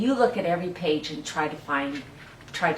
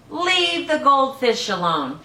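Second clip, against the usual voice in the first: higher, a bit slow mom warning; mom scolding; 7 semitones higher, 5 dB louder, slower than usual. Acted. Mom telling the boy to leave the goldfish, higher warning. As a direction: warn him off like mom.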